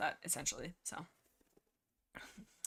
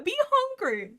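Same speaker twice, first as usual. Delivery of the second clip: squealing; 14 semitones higher, 5 dB louder, slower than usual. Real, not acted. Laughing about hungry Fireheart.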